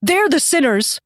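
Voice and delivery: high, loud desperate begging; terrified pleading. Acted. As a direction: beg for your life, shrieking. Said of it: "he cried".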